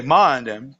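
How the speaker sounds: "mind them" run together as MA'N'EM; MA'N'EM, with the weight on MA'N; Southern contraction. Shown as a demonstration. Storyteller explains the saying, run together as ma'n'em. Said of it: "But you say".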